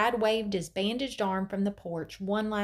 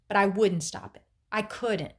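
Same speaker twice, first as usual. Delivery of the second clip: ordinary delivery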